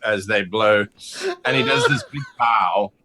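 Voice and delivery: ordinary talk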